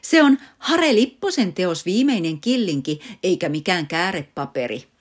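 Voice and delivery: loud, high outcry easing at the end; shrieking protest. Acted. Mother rescuing the artwork from father, shrieking. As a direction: protest loudly, then settle. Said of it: "äiti parkaisi".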